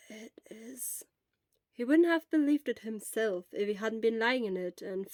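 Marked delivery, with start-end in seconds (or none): 0.1-1.0 soft whispered reply; whispered insistence. Acted. Shura in the sleeping bag answering Hisashi, whispered. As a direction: breathe it, sure but spent.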